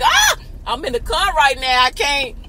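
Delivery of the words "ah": excited scream. Retold or imitated. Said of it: "'cause I'm just like".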